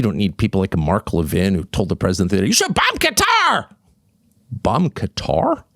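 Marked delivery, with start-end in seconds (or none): 2.5-3.7 high-pitched hammed-up cry; exaggerated falsetto war cry; mock hawkish adviser. Mocks adviser's call for bombing. 4.5-5.6 slow, questioning repeat of BOMB QATAR; baffled, higher questioning tone; incredulous echo. Real, not acted.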